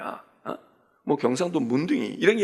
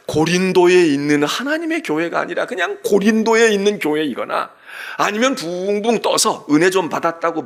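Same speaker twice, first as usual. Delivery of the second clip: louder, driving sermon delivery; forceful preaching; 3 semitones higher, 5 dB louder than usual. Acted. Pastor urging the congregation, preaching with force. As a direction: press the point home to the pews.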